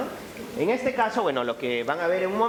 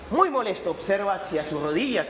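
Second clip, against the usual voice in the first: usual speaking voice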